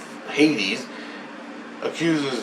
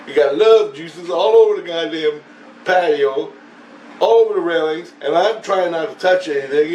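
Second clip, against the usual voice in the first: louder, higher complaining rant; retold rant; 5 semitones higher, 4 dB louder than usual. Man complaining about mess on the patio, ranting. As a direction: rant it out loud and raised.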